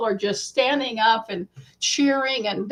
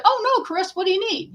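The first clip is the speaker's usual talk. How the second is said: kindly greeting; 8 semitones higher, faster than usual. Retold or imitated. Dee greeting fan kindly.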